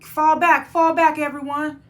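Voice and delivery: loud radio command; calling out orders. Retold or imitated. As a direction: shout the order to retreat. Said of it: "He's like".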